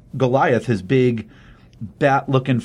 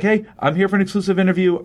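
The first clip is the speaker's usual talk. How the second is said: higher, fast, eager reporter pitch; 7 semitones higher, faster than usual. Retold or imitated. Retelling a reporter landing for an exclusive, higher and quick. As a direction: rush it out like a keen TV reporter. News reporter arrives by helicopter.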